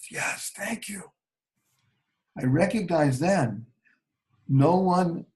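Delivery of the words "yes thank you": soft breathy whisper, slow; whispered thanks to the nurse from a hospital bed. Retold or imitated. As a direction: barely whisper your thanks. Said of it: "I couldn't express myself".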